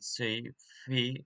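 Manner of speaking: normal talking voice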